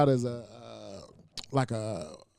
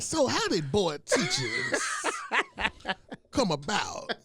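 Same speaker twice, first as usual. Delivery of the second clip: much higher, slow mock interviewer voice with laughs; 10 semitones higher, slower than usual. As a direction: parody the host's question, laughing. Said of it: "He like".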